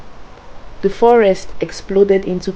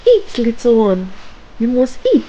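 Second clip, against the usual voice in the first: higher, insistent witch; pushy old woman, sweetness dropped; no longer sweet; 6 semitones higher, faster than usual. A narrator's reading. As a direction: press the order on the child. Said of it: "Her voice was no longer sweet".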